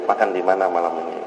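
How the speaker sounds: low, soft, quick murmur; mock whisper. Husband asking his wife about dinner, whispering low. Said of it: "Ke ibu kan bisik-bisik".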